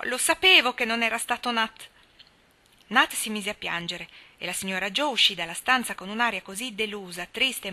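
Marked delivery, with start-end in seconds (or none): 0.0-1.9 high, loud triumphant cry; shouting. A narrator's reading. Daisy at the table, loud relieved shout. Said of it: "Daisy gridò".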